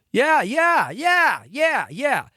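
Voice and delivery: loud, high dismissive yeah-yeah; impatient yeah yeah. Retold or imitated. Agent brushing off the actor, loud and high. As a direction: wave him off loudly with a high repeated yeah.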